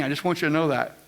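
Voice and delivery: usual speaking voice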